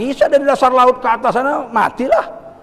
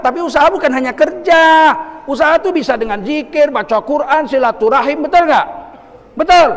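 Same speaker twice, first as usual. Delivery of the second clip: loud, raised preacher's voice; forceful preaching; 6 semitones higher, 5 dB louder than usual. Real, not acted. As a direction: drive each point home to the congregation. (ceramah lantang berapi-api)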